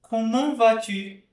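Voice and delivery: "Comment vas-tu" slowly, closed nasal on "comment"; slow and careful "Comment vas-tu"; model French. Shown as a demonstration. Teacher modelling a closed nasal sound. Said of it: "É um som mais fechado".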